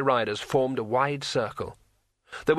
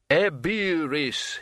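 slow, higher-pitched spell chant; intoned incantation; 4 semitones higher, much slower than usual. A narrator's reading. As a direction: intone it like a word of power, drawn out. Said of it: "he intoned".

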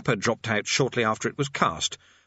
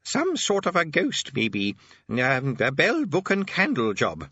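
put-on higher-pitched, halting old man; tentative old Arch-Chancellor; 4 semitones higher, slower than usual. Old Arch-Chancellor guesses at a ghost, hesitantly. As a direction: feel your way through the idea.

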